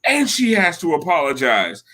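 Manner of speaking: high-pitched mock yell; intense, indignant tirade, imitated; retold screaming. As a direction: re-enact a heated demand, pitch way up but volume held back. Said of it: "And I'm screaming at him".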